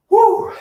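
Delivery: high, loud whoop of effort; excited whoop. Real, not acted. Coach whooping to viewers during cardio, high and loud. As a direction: let out a sudden high woo.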